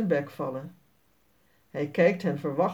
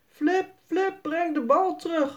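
much higher voice calling after a runaway dog; 9 semitones higher than usual. A narrator's reading. Everyone calls to Flip to bring back the ball, much higher. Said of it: "roepen ze allemaal".

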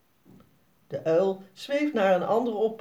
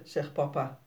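usual speaking voice

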